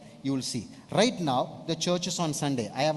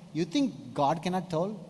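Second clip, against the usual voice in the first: normal talking voice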